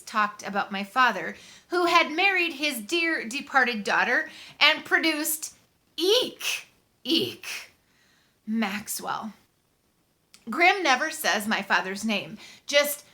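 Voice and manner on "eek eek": mock shriek. Boy mocking how his father produced him, mock shriek. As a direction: squeak it out like a horrified little scream.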